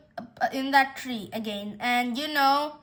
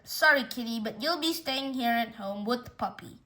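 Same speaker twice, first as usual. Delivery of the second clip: usual speaking voice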